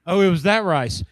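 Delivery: high, loud teasing daughter; mock daughter voice. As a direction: sing it out like a teasing kid. Daughters quoted mocking dad.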